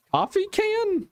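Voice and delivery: high-pitched, incredulous COFFEE CAN; incredulous echo. Real, not acted. Host questioning the coffee can remark, high and incredulous.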